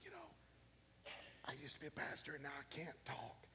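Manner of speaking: imitating the man's lost voice in a whisper; voiceless whisper. Pastor imitating the voiceless teacher, whispering. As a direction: breathe it out, no voice. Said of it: "He's sitting there going".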